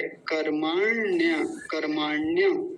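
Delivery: कर्माण्य slow, half ण run into य. Shown as a demonstration. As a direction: hold मा in कर्माण्य, then join ण्य. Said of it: "णकार आधा है".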